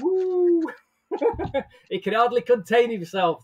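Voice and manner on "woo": excited whoop. Real, not acted. Co-host cheers at the intro.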